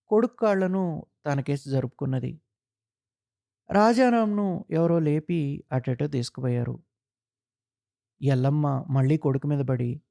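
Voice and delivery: ordinary delivery, nothing marked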